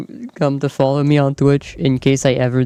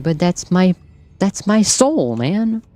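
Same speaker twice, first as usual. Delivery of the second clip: higher-pitched, plaintive objection; higher, hammed-up wounded protest; mock protest; 5 semitones higher, slower than usual. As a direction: plead your case with playful hurt. Plaintive comeback to imagined warning.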